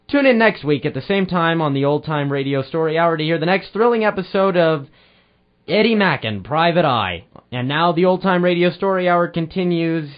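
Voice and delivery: put-on bright, loud old-time radio host read; booming sign-off announcer. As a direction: sell the show like a vintage announcer. Radio host signs off the show.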